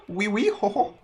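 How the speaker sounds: mock French accent. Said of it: "crappy French right now".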